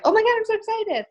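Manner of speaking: high, squealing mock gush; gushing excitement. Mocking a blue personality type, high excited squeal. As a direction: imitate a bubbly party girl who can't contain herself. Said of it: "They're just like".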